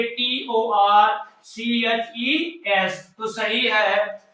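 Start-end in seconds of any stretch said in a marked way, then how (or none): 0.0-3.0 slow, deliberate spelling. Shown as a demonstration. Teacher slowly spelling torches for the class. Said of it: "teacher spelling the word slowly".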